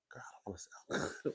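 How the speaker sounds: secretive gossip whisper. Real, not acted. Gossiping to viewers about the little boy, whispered. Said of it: "I don't know why I'm whispering".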